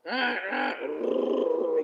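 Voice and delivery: high, loud seagull cries; imitated raucous gull call; seagull squawk. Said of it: "carries on like a seagull at the beach".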